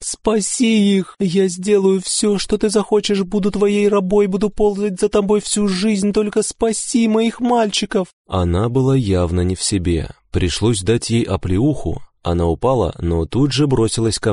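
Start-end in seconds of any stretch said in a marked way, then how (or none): 0.0-8.1 mother begging in tears; desperate sobbing. A narrator's reading. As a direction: plead for her sons through tears. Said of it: "Она рыдала".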